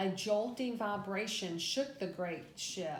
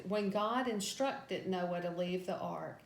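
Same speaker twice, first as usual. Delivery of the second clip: ordinary delivery, nothing marked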